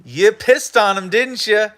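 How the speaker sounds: ordinary delivery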